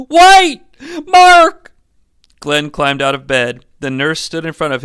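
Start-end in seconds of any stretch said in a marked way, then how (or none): 0.1-1.7 very high, loud call; desperate shout. Acted. Glenn calling after departing Mark, desperate shout. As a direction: scream after him to stop.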